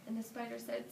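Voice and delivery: ordinary delivery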